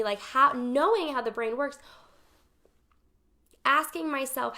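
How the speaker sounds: normal talking voice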